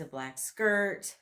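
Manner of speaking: usual speaking voice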